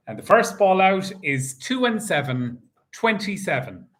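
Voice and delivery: steady sing-song number calling; bingo caller. Acted. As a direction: call each number out to the hall. Calls the bingo numbers live.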